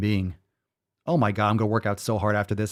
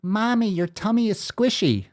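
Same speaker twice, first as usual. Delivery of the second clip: put-on high-pitched little kid; child's voice; 8 semitones higher than usual. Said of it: "read it in the voice of a child".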